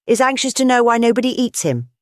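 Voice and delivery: high, forced merry mockery; feverish gaiety. A narrator's reading. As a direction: tease with nervous glee. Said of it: "with a feverish gaiety".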